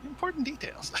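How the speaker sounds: very high squeaky aside. Pretend. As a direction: pipe it up high and silly.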